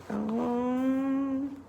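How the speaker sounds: ordinary delivery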